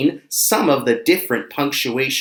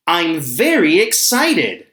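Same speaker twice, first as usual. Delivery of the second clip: higher, slower, with an excited lift on very; 4 semitones higher, 3 dB louder than usual. Shown as a demonstration. Teacher imagining going to a best friend's birthday, excited. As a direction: stretch it out with real excitement in the voice. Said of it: "hear the excitement in my voice".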